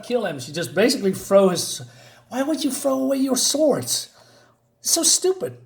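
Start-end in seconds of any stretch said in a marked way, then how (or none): 2.3-5.6 exasperated. Real, not acted. Exasperated at character discarding swords.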